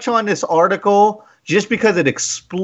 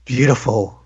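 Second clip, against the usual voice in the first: creepy man voice; 6 semitones lower than usual. Acted. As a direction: whisper it like a lurking creep. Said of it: "in my most creepy man voice".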